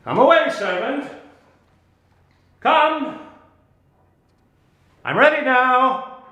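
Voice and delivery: high, loud summoning call. Acted. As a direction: call the spirit from afar, ringing and commanding. Magician summoning his spirit servant.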